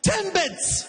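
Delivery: high, curt customer saying Timbits; mocking customer. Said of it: "She's like".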